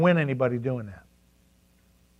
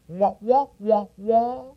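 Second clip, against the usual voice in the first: muffled trombone wah wah; Charlie Brown wah wah; 5 semitones higher, slower than usual. Retold or imitated. Preacher mimics garbled talk as wah wah.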